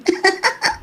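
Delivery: high excited giggle; shy giddy giggle. Acted. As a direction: giggle like a girl with a crush. Girl giggles about her neighbor.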